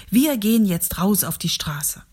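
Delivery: plain reading voice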